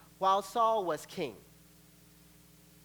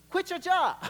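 pitched up, louder, pressing the order again; higher insistent God; 11 semitones higher, 7 dB louder than usual. Acted. God insisting to the doubting believer, higher. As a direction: repeat it, more insistent.

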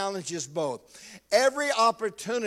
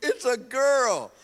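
louder, higher mocking replay; teasing replay of his wife's words; mock announcement; 6 semitones higher, 5 dB louder, slower than usual. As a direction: echo it as if it were tragic news, hammed up. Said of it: "Like that was gonna be bad news".

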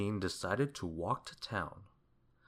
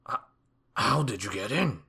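loud, stammered shock; startled gasp; 2 semitones higher, 6 dB louder than usual. A narrator's reading. Mister Mean finding the wizard in his kitchen, gasping. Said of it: "gasped Mister Mean".

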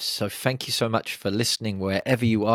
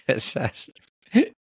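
higher, drawn-out word through a grin; said with a smile; 5 semitones higher, 3 dB louder, much slower than usual. Shown as a demonstration. Guest trying the coach's challenge, said with a forced smile. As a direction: beam through the word, slowly and amused. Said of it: "Try saying catastrophe with a smile".

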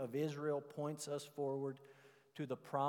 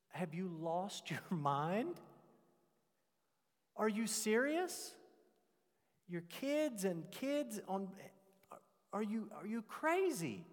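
slow, high, disbelieving questions; stunned and appalled, pitch climbing; incredulous; 6 semitones higher, slower than usual. Retold or imitated. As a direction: ask like he's lost his mind. Retelling his shocked reply to friend.

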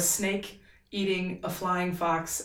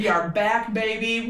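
usual speaking voice

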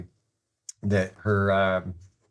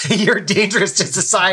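high, loud mock outrage; dead-serious alarm; 11 semitones higher, 7 dB louder than usual. Chess master berating a weak player who drives, dead-serious alarm. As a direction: imitate an appalled passenger scolding. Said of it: "He was dead serious".

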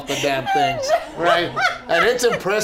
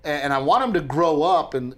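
normal talking voice

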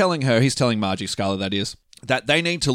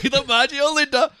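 high-pitched mocking parrot, laughing; mock echo; 14 semitones higher than usual. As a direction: echo it back in a high silly voice. Echoing the co-host in mock voice.

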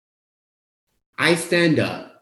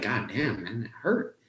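softer, wounded mutter to himself; hurt; 10 dB quieter than usual. Retold or imitated. Stung by his best friend's words, soft and hurt. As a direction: quietly take the hit.

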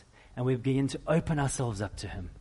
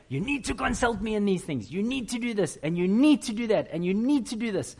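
higher, lecturing nag voice; higher-pitched mock telling-off; mock nagging wife; 6 semitones higher than usual. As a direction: scold like a nagging spouse. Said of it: "didn't say".

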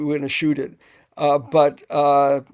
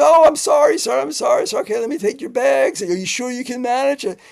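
much higher, flustered apology; apologetic driver; 8 semitones higher, 4 dB louder than usual. Retold or imitated. Limo driver apologizing to the director, high and flustered. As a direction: gush sorry in a high, eager voice. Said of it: "He goes".

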